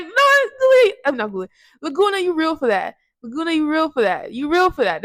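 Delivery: high, loud disbelief easing to teasing; outraged fan. Real, not acted. Vlogger reacting to Laguna's line, high and loud. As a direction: react like a fan yelling at the screen. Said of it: "And I'm like".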